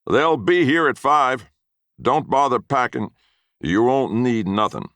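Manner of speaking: high, loud slow taunt; mocking yell. A narrator's reading. Grandfather jeering after the scared boy, loud and high. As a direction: jeer it across the yard. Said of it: "he yelled after me".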